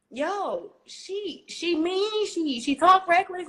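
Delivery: high, loud mocking teammate voice; imitating teammates. Teammates complaining about her, high mocking imitation. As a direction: ham up the gossiping teammates. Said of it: "everybody would be like".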